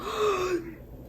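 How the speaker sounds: sharp high gasp; dramatic gasp. Real, not acted. Reader gasping at the book cover, dramatic gasp. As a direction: gasp theatrically. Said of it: "I'm so dramatic".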